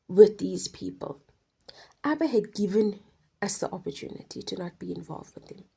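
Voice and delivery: ordinary delivery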